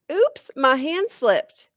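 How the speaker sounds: slow, loud fake oops; mock innocent. A narrator's reading. Girl mockingly excusing her rock throw. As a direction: pretend it was an accident.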